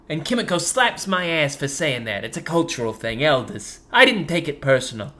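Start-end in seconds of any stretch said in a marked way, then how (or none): none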